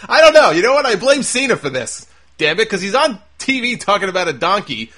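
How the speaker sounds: high, loud burst of comic frustration; exasperated outburst. Acted. Host blaming Cena for his slip, exasperated and loud. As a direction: blow up in exasperation, high-pitched.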